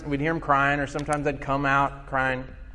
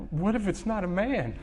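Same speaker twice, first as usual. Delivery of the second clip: put-on higher, quick child voice; son's question; 4 semitones higher, 8 dB quieter, faster than usual. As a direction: play the worried little boy, pitched up. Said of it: "one of my sons said".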